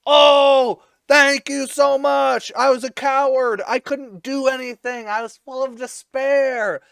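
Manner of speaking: high, drawn-out mock grateful holler; yelling after them. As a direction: holler thanks to a departing boat, hammed-up. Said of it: "he's yelling after them".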